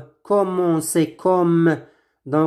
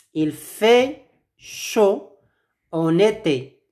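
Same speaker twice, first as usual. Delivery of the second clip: slow model phrase; slower than usual. Shown as a demonstration. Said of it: "Répétez après moi".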